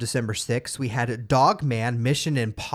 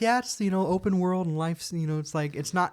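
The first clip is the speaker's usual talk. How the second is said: excited, high-pitched; 6 semitones higher than usual. Retold or imitated. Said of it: "I was telling you about it".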